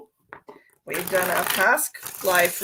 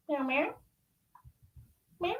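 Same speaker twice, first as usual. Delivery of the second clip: high, soft imitated meows; meowing at cat; 7 semitones higher, 8 dB quieter than usual. Owner meowing to her cat, high and soft. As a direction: meow back at the kitty.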